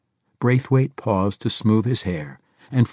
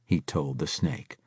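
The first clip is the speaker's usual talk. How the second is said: ordinary delivery, nothing marked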